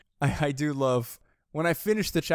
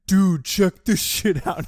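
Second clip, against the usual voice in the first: slow, louder, hammed-up boast; mock Nobunaga brag; 4 semitones higher, 8 dB louder, slower than usual. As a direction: drawl it out like a smug show-off with a new gun. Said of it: "Oda Nobunaga".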